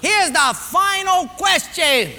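slow, high quizmaster shout; loud announcement. Acted. Personnel guy opens the last round, slow shout. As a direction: announce it big and drawn out.

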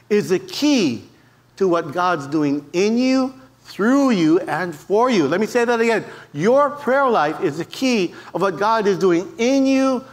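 usual speaking voice

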